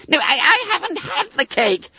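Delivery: very high, stammered squeal; alarmed denial. Acted. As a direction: protest high as the boys close in. Bunter, alarmed, denies it.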